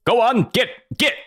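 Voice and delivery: high, mock shooing call; shooing away. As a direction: shoo off a pack of animals. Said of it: "Catan turns to the wolves".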